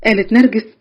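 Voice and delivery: plain reading voice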